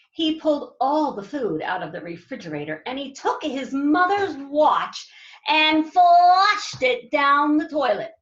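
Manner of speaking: usual speaking voice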